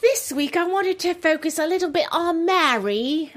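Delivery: light put-on English accent; a hint of a posh English presenter. Host slipping into English accent for gossip segment, light English accent. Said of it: "Oh, I like your English accent".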